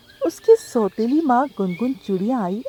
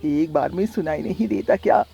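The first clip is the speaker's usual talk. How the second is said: cruel stepmother; 4 semitones lower than usual. Acted. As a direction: taunt her while beating, pitched low. Stepmother beats Tuni. (नीची धीमी आवाज़ में ताना मारती माँ)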